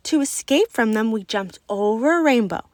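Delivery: plain reading voice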